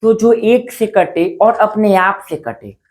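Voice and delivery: drawn out so the rule sinks in; slow, careful rule for students to remember; slow, for memorising. Shown as a demonstration. Teacher to students, spelling out the rule slowly. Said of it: "याद रखिएगा".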